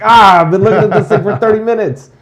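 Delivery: hammed-up exasperation; exasperated outburst. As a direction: blurt it out like you can't believe you missed it. Someone frustrated missing obvious thing.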